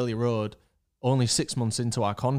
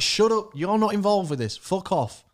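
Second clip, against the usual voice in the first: higher, louder retold rebuke; indignant, pitched-up dismissal; exasperated telling-off; 8 semitones higher, 4 dB louder than usual. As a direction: act out shutting down a meddler. Retold telling-off of interfering concierge.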